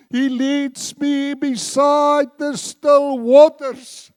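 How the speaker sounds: fervent preacher's proclamation, slow and loud; full-force preaching. Real, not acted. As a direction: thunder the psalm out to the congregation.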